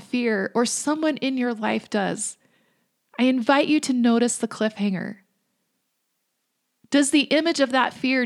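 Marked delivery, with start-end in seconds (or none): none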